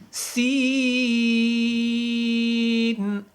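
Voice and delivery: سین stretched very long and said firmly; long firm seen. Shown as a demonstration. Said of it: "اب سین بھی سختی کے ساتھ ادا ہوگا". (سین کو سختی سے لمبا کھینچیں)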